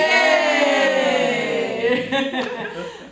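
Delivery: loud bright laugh; cheerful laugh. Shown as a demonstration. After the chant, loud laughter.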